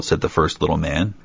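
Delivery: usual speaking voice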